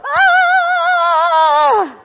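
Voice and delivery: high, loud, drawn-out wail; terrified wail. Retold or imitated. Running from the barking dog, a long, high, loud wail. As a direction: wail in mock panic.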